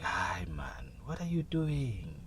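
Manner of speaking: whispered, slow coaxing voice; coaxing blankets. Pretend. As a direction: lure him back to bed. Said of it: "the covers were like".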